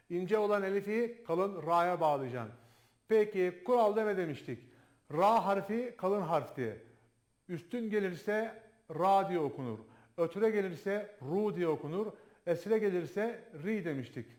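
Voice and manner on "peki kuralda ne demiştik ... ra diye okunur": ordinary talk, nothing marked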